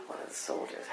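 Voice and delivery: hushed, playfully impressed whisper; awed whisper. Pretend. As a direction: whisper it like thrilling gossip. Mock awe about the soldier.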